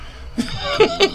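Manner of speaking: short squeal of laughter; high laugh. Real, not acted. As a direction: burst into a high giggle. Laughing about being posh.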